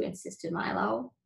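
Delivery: usual speaking voice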